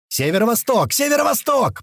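high-pitched urgent yell, rising on the repeat; yelled report. A narrator's reading. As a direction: call out the direction across the radio. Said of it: "проорал Гера".

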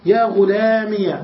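"غلامي" slow, said "ghulamiya"; ya with fatha. Shown as a demonstration. Teacher showing the fourth form, -iya ending. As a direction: end "غلامي" on a clear -iya. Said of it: "بفتح الياء".

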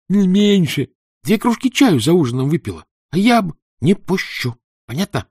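put-on high, loud boyish retort; angry shout. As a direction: snap back, loud and high. Boy shouts back at Tanya.

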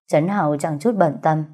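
plain reading voice

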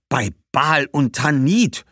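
langsam und laut, Tanit steigend; schimpfend. Acted. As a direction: schimpft empört. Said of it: "schimpfte Badbal". (Badbal schimpft „Bei Baal und Tanit“)

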